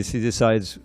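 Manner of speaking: ordinary talk, nothing marked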